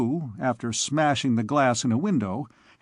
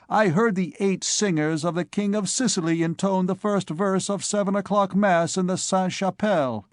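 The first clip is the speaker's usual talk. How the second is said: put-on cheeky young scholar; 5 semitones higher than usual. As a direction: brag lightly to a friend. Joannes boasting to friend.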